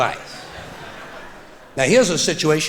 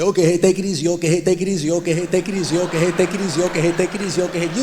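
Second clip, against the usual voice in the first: rote goodbye loop; much faster than usual. Retold or imitated. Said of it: "you feel a little stupid".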